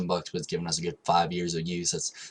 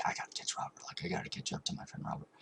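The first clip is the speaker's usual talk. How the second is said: whispered to himself; 10 dB quieter, faster than usual. Retold or imitated. His own thought, retold.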